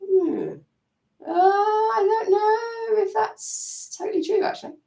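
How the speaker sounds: slow, high, wavering doubt; high, slow uncertain musing. Shown as a demonstration. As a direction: let the voice waver, unsure. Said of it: "hear your inner voice start to change".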